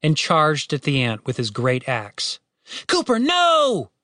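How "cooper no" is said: high, stretched warning call; long high-pitched protest; shout. A narrator's reading. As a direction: yell to stop someone mid-charge. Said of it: "Tim shouted".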